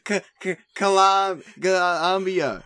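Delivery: high, slow stammered Columbia; a friend mimicking Michael stammering out Columbia; mock stutter. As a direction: stumble through Columbia in a teasing stammer. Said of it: "He stuttered just that much".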